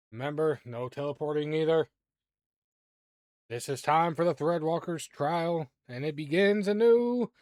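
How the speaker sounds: loud, rising ringmaster proclamation; beam master announcing. Acted. Beam master opens the Threadwalker's trial, announcing loudly and building.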